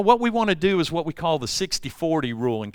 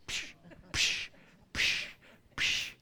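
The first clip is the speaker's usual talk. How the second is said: unvoiced psh psh slaps; mock spanking sounds; 14 dB quieter, slower than usual. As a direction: puff out slap noises. Mom spanking sound effect.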